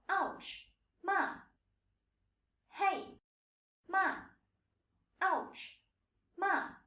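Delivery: "Ouch", "Hey", "Ma" each high and falling sharply. Shown as a demonstration. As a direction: give "Ma" the quick drop of a mock "Ouch". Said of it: "when you get hit rather hard and it hurts".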